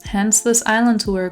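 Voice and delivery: normal talking voice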